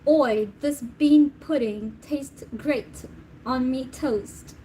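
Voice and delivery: hammed-up London accent, slow and drawn out; mock British accent. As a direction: play a cheeky Brit praising beans on toast. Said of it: "Is that how it goes?".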